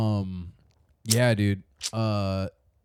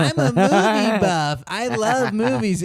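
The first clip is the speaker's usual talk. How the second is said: slow, higher-pitched mock boast; mocking quote of friend; 7 semitones higher, 3 dB louder, slower than usual. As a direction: drag it out to tease him. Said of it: "you're like".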